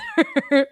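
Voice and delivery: a sudden squeaky burst of laughter; high laugh. Real, not acted. Guest laughing at her own family tangle, squeaky laugh. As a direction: laugh off the messy family tree.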